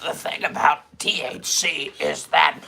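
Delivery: halting, strained, broken-voiced politician impression; RFK Junior. Impressionist trying out RFK Junior, strained croaking voice. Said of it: "my first attempt at R F".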